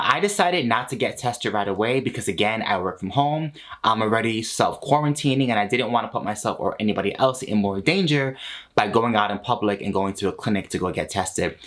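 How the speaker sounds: usual speaking voice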